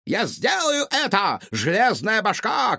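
triumphant manic yell; mad scientist shout. A narrator's reading. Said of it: "крикнул учёный".

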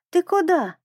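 put-on high, childlike questioning call. A narrator's reading. Little penguin calls after a passing fish, calling out. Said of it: "крикнул Пик рыбе".

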